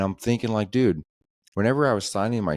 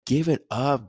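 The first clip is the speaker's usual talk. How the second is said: exasperated brush-off; 8 semitones higher, slower than usual. Retold or imitated. Telling off a pushy salesman.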